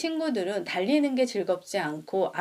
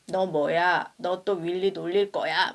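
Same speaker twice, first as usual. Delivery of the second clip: lightly voiced tough kid telling off a bully; mild mock scolding. Hugh scaring off the bully Buster, mock stern. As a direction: confront the bully, a touch stern. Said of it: "막 이러니까".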